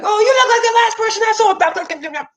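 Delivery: high fast put-on voice; squeaky voice of someone's trauma; mock trauma voice.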